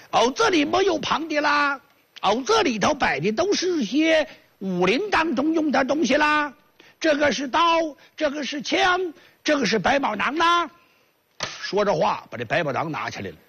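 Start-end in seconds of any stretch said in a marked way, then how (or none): 0.1-10.7 put-on high, lilting southern Chinese accent; chirpy southerner hawking his wares, raised pitch. Vendor with a southern accent shows off his goods, high lilting voice. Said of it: "南方人".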